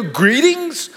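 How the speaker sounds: "Greetings?" pitched high, disbelieving; incredulous question. Retold or imitated. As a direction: throw "Greetings?" back in disbelief. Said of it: "and I wanna go".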